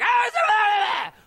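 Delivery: long, very high, loud gibberish yell; screechy agent impression. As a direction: caricature her talk as one drawn-out shriek. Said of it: "That's just how she talks".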